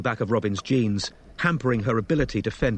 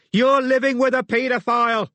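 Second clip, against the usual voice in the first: high, loud roar; 14 semitones higher, 6 dB louder than usual. A narrator's reading. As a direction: roar over the racket. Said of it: "Robin roared over the racket".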